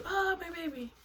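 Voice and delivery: exaggerated high-pitched 'my baby' fretting; mock fussing mother. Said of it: "that's why I'm not like".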